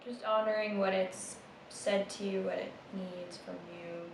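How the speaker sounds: soft, unhurried meditation guide; hushed meditation voice trailing into a whisper; guided meditation. Acted. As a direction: ease them into noticing the breath, gently.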